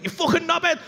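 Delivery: high-pitched mock-furious outburst; angry. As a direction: imitate a raging cyclist, pitched high. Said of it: "I've never seen anyone so angry".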